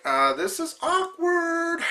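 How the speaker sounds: high, slow, uneasy drawl; awkward. Retold or imitated. Teammates caught in the awkward moment, high and slow. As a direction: let it hang, squirming and embarrassed.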